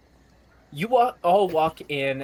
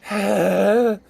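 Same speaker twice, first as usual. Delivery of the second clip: heavy sigh; 6 semitones higher, 6 dB louder than usual. Acted. Trapped halfling freed.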